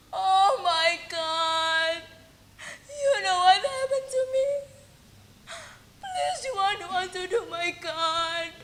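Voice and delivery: weep it out to God; crying. Acted. Heartbroken mother kneeling and praying, softly crying. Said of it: "while crying".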